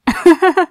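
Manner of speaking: giggle. Acted. Yandere giggle at captive.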